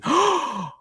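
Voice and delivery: sharp high hammed-up gasp; theatrical gasp before a fake reveal; mock gasp. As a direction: fake a dramatic gasp of shock.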